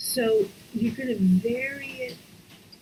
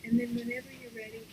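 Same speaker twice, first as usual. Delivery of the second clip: gentle meditation lead; guided breathing. Acted. Leads a breathing exercise.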